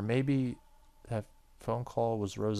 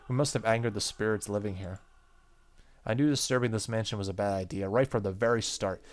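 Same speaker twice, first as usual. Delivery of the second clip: ordinary talk, nothing marked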